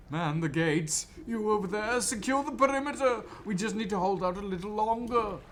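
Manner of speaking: high, loud panicked commands; frantic officer shouting. Acted. Officer shouts hurried orders.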